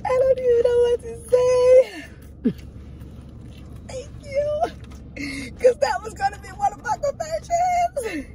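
high, slow, tearful voice; choked up with happy tears; emotional crying. Real, not acted. Moved by partner's praise.

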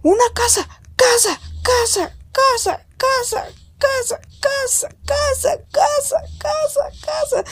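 gritos agudísimos de emoción que suben cada vez más; euforia al descubrir. Pretend. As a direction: chilla de alegría como quien halla refugio. Descubre una casa, eufórico. (grita emocionado al encontrar una casa, cada vez más agudo)

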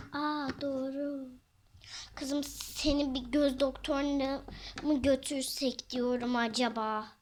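normal talking voice

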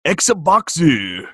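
exaggerated fake Japanese accent with added end vowels; mock Japanese accent. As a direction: put on a hammed-up Japanese accent, stretching the last vowel. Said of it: "like a Japanese accent's always just like".

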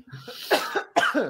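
harsh wet cough; a real chesty cough. Real, not acted. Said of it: "sorry, I keep coughing".

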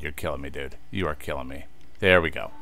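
normal talking voice

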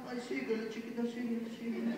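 quiet, coaxing cheer; soft egging on. Acted. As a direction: murmur encouragement to the dancer. Egging on a dancer softly.

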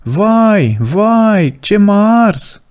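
high, slow wailing cry of a scorched devil; slow, high-pitched howl of pain. A narrator's reading. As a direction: wail it out as if burned by fire. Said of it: "iar el striga".

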